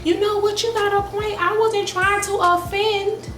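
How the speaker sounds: very high, placating mock voice; imitating client backing down. Client backing down from the angry stylist, high placating voice. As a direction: squeak it out, backpedaling fast. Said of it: "she tells me calm down".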